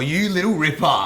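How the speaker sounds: slow, drawn-out Australian exclamation; broad Australian. Shown as a demonstration. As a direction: say it like a true-blue Aussie. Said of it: "the most Australian voice".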